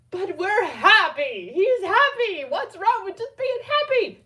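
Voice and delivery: loud, high-pitched mock whine; protesting. Defending the happy dog to her friend, loudly protesting. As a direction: plead it like an overexcited kid. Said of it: "And I'm just like".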